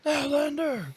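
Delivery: high monster cry; Cookie Monster voice. Retold or imitated. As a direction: call out like the monster. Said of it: "that's his word".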